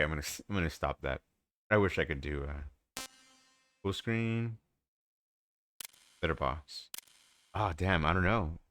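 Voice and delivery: ordinary talk, nothing marked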